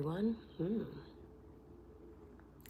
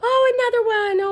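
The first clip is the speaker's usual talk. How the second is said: much higher and louder squealing whisper, fast; excited squeal; 16 dB louder, much faster than usual. Real, not acted. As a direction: squeal at another gift. Second gift revealed.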